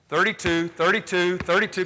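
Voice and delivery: loud, rising call of the play. Real, not acted. Coach calling out the defense, loud and high. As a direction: shout the defense call across the court.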